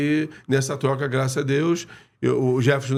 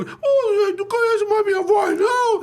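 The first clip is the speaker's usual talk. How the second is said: very high squeaky imitation of the coach; Jair's little voice; 4 dB louder, slower than usual. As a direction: mimic a high, thin voice scolding on the phone. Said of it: "com aquela vozinha dele".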